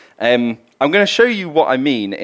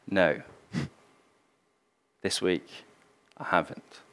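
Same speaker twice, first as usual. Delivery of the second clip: softer, small guilty admission; ashamed quiet no; 6 semitones lower, 13 dB quieter than usual. Retold or imitated. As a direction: own up quietly. Bill ashamed under questioning.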